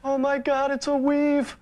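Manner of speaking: high-pitched mock-shocked outburst; mock hysterics. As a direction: squeal it as a gleeful false discovery. Mock exposing of the host's hairpiece.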